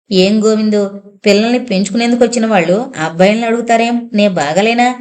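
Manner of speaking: plain reading voice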